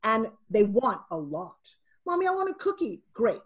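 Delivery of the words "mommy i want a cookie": put-on high-pitched child begging mom; little kid. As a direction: imitate a small child asking for a treat. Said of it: "I've got these two little twins".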